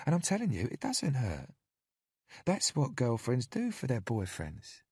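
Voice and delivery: high, sugary caretaker voice; falsely sweet and cajoling; sweet coaxing woman. A narrator's reading. Narrator voices Candy.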